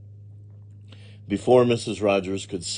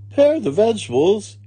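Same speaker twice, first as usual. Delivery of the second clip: amused exclamation; 13 semitones higher, 8 dB louder than usual. A narrator's reading. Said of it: "Amelia Bedelia laughed".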